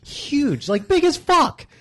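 awed exaggeration. Awe at giant funeral heart.

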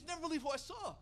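breathless-news delivery, mock excitement; excited news. Wife runs out with big news.

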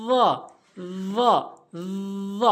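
"য" as heavy voiced th; tongue tip on front-tooth edges for "য"; য demonstration. Shown as a demonstration. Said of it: "এভাবে উচ্চারণ করবেন". ("য", জিহ্বার আগা দাঁতের আগায়)

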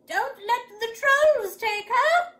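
high, slow, loud call. A narrator's reading. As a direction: holler a warning from far off. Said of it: "called Grandma".

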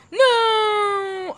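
loud high cry of defeat; anguished shout. Real, not acted. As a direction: wail as he falls. Falls off, cries out.

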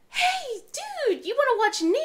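high, loud eager call; excited mom. Retold or imitated. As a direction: rope the kid in, bright and loud. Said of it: "I'm like".

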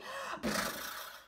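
long noisy blown-out breath; exasperated puff. Acted. Horse baffled by the snail's lead, exasperated puff. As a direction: blow out air in frustration.

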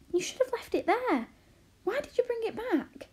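high, exasperated reproach; dismayed, pleading complaint; exclaimed protest. A narrator's reading. Arrietty protests at returned letter.

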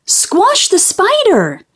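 high, drawn-out cry; squealed order. A narrator's reading. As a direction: squeal it slowly, pitch falling at the end. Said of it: "squealed the teacher".

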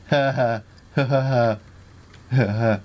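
loud, repeated bursts of laughter; mocking laugh. Real, not acted. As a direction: laugh at a foolish opponent. Laughs at opponent falling through portals.

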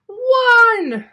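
high, very loud drawn-out call; climactic countdown shout. Acted. The computer calls the final count before the blast, shouting. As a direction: blare the last count at full force. Countdown reaches its last number.

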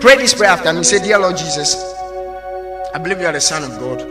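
ordinary talk, nothing marked

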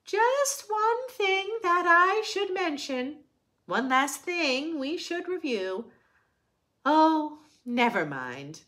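lively, sing-song picture book reading; reading to children. A narrator's reading. Reading a rhyming picture book to children, sing-song. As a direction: read it brightly to the little ones.

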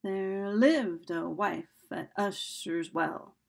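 slow line with "lived", "wife", "Ush" and "Well" stressed; iambic stress. Shown as a demonstration. Teacher letting students hear stressed syllables, slow iambic stress. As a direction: lean on every second syllable of "There lived a wife at Usher's Well". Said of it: "you can hear how those things are stressed".

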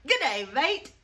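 a light mock Aussie greeting; g'day mate in a hint of Australian accent. Switching to Australian accent to greet viewers. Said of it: "Wrong accent".

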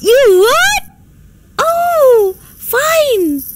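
high, gushing acceptance; thrilled, high-pitched thanks; delighted relief. Acted.

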